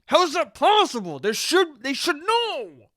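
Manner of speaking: high, exasperated mock wail, last word drawn out; mad outburst. Customer after hearing no repair time, mad outburst. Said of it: "And then you get mad".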